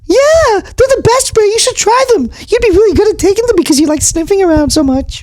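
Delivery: high-pitched nervous mouse puppet; squeaky puppet mouse. Acted. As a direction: gush about his new life. Tutter continues.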